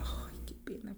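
hushed mock apology; quiet sheepish aside; guilty whisper. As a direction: whisper it, embarrassed.

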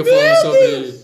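high wailing laugh; cry-laughing in disbelief. Real, not acted. Laughing mock-cry at photo.

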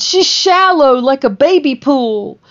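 put-on high pleading voice, baby pool line; ex's confession. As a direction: raise the voice up high for the ex. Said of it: "And she said".

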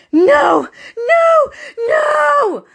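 high, drawn-out scream of refusal; acting out a desperate high scream; re-enacted panicked scream. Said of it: "I just start screaming".

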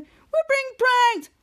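hammed-up proclamation; retold alarm. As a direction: call it out high and dramatically. Acting out alarmed cry.

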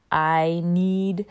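I need said forward in the face; mask resonance phrase. Shown as a demonstration. Practicing voice resonance, says I need through the mask. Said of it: "practice saying other things like".

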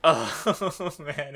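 ordinary talk

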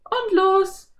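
put-on very high, cheering girl; girl whooping as the ride starts; cheering Anne. A narrator's reading. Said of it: "jauchzte Anne". (jauchzendes Mädchen)

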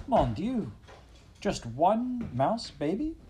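put-on high, slow French-accented dismay; a French mother aghast at one baby; French mother mouse. A narrator's reading. French mother mouse to her husband, high slow French dismay.

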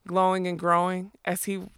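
ordinary delivery

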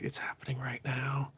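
eerie hushed whisper; unvoiced, creepy whisper. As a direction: breathe it out like a warning. Character's eerie whispered line.

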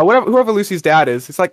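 normal talking voice

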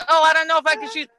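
high, hurried mock dithering; mocking imitation. Homeowner mimics what everybody's saying, mocking. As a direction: mimic the hesitant people, high and quick.

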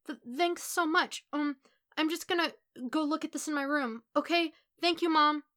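put-on high, flustered boy's voice; nervous son. A narrator's reading. Son thanks Mom before fleeing to his room, high and flustered. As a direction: stammer thanks, eager to get away.

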